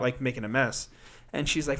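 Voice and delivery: ordinary delivery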